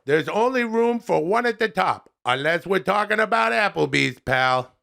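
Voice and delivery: hammed-up buddy-to-buddy restaurant rating; Adam Sandler. Voices Adam Sandler's reviews.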